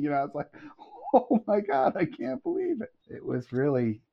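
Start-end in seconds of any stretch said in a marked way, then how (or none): 1.1-2.9 very high-pitched retold amazement; amazed disbelief. Recalling the author's embrace, amazed disbelief. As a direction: marvel in a high, awestruck squeal.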